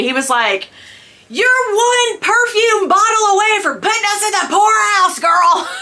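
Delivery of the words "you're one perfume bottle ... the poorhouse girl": high mock yell with a laugh; hammed-up angry husband shouting; wife mimicking her husband yelling about shopping; imitating his yell. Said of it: "he yelled at me".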